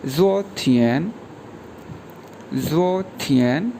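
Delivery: ज़ो थियन said slowly, rising on ज़ो; careful teacher model of ज़ो थियन; model pronunciation. Shown as a demonstration. Teacher modelling Chinese word ज़ो थियन for students, slow and rising. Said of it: "उसको बोलते हैं".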